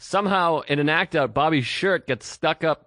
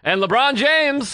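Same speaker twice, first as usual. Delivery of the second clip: high, loud, drawn-out name drop; sarcastic, exaggerated shout-out; mock proclamation; 11 semitones higher, 5 dB louder, slower than usual. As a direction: throw the name in absurdly, pitched way up. Absurd mock insertion of a name.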